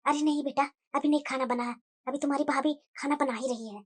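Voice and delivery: secretive soft murmur; speaking softly. Acted. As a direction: keep it very low so nobody overhears. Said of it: "इतना धीरे धीरे बोल रही है".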